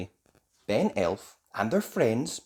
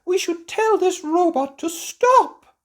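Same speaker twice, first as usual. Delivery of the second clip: very high, loud queen's voice; alarmed queen; 8 dB louder than usual. A narrator's reading. Queen Thistle facing the runaway robot, high alarm. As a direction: demand it in a high, drawn-out voice.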